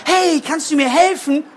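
high, small bird voice; little bird voice. Acted. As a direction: ask like a tiny shy bird. Little bird asks donkey.

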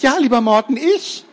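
very high eager squeak; Gerti calling out. Acted. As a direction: call out adoringly to Morten. Gerti tries to call out.

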